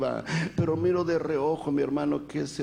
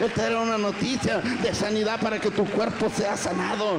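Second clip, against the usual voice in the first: preaching=22; 5 semitones higher than usual. Acted. Preaching proclamation of healing.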